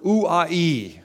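"ouai" said as oo-ah-ee, three open vowels; Greek ouai. Shown as a demonstration. Pastor teaching the congregation the Greek word, sounding it out. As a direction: glide "ouai" slowly from oo through ah to ee. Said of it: "the word woe in the Greek language is the word".

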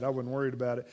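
usual speaking voice

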